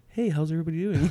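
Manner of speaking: lightly put-on polished, accentless greeting; interview voice. Imitating himself greeting hometown friends, mock-proper interview voice. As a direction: greet them in neutral, interview-ready English. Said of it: "I don't feel authentic".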